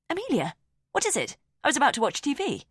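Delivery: a casual younger woman, unbothered; lightly voiced sister. A narrator's reading. Julia answers door, casual.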